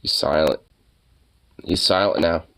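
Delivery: ordinary delivery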